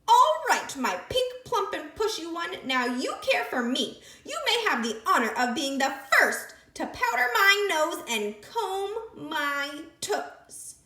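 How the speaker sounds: high, loud, gloating creature voice; sandwich creature. Acted. Creature bossing the captured piglet. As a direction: gloat over her catch.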